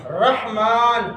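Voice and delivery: الرحمن slowly as "RAH-maan"; correct pronunciation. Shown as a demonstration. Teacher completing the name for class, correct pronunciation. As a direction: clear h, long final vowel in الرحمن. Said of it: "زبر ہے".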